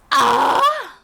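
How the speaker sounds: high, loud shriek; re-enacted scream. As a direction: scream in shock at the ring. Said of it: "I'm screaming at this point".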